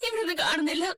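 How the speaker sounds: wailed, helpless report; sobbing, at wits' end; crying despair. Acted. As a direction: cry it out in despair. Mother still searching, on phone.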